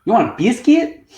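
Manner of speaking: confused cashier asks about the biscuit, high mock Southern; Southern lady. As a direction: mimic a baffled Southern cashier. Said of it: "She's like".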